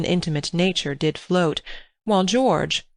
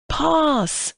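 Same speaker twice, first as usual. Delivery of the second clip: high, ringing cry of pass; shouted pass; 10 semitones higher than usual. A narrator's reading. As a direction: call for the ball across the pool. Boys playing football in pool.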